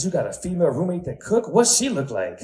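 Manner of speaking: high eager question; intrigued homeboy. Retold or imitated. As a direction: lean in, interested.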